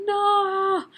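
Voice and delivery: long, high, loud wail of no; horrified cry. Retold or imitated. Reader reacting to a beheading, horrified cry. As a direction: cry out in dramatic protest.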